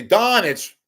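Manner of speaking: high, loud call; retold yell. Fan trying to get Rickles to look up, yelling. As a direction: yell the name to get noticed. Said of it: "I'm yelling at him".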